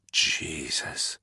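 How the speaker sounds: low, soft breathy exhale of a word; breathed. A narrator's reading. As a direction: let it out on a shocked breath. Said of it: "Danny breathed".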